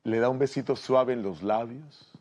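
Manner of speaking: ordinary delivery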